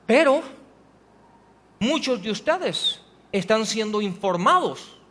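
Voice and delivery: ordinary talk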